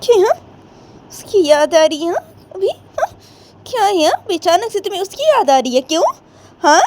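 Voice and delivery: very high, incredulous questions; indignant Uma questioning. Acted. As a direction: demand an explanation in a high voice. (हैरानी और चिढ़ भरे ऊँचे सवाल)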